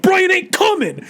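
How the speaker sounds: high, shouted defiant line; retold shout. Roman yelling at the mechanic, high shouted defiance. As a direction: yell it at the mechanic. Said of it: "he's like".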